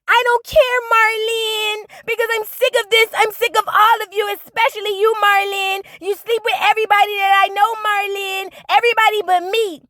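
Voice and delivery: high-pitched imitation of an angry daughter; mock shrill rant. Daughter snapping at her sister, high mock rant. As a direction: whine it up high at Marlene, fed up. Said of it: "And Flo".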